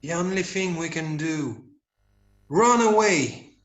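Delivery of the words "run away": urgent cry. Acted. Children decide to flee.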